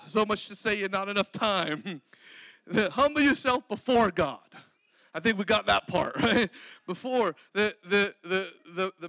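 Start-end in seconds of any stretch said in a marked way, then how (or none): none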